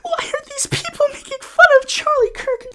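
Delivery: very high, mocking whine; high mock-innocent whine; mocking whiny voice. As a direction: squeak it out like a fake innocent. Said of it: "be like".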